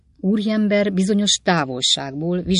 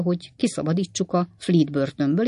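ordinary delivery, nothing marked

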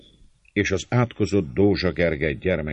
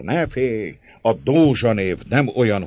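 high, loud and impassioned; fervent, forceful reassurance; heated cry; 6 semitones higher, 6 dB louder than usual. A narrator's reading. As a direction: proclaim it with fervour, voice raised. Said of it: "kiáltott György indulattal".

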